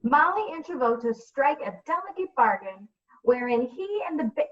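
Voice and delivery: ordinary talk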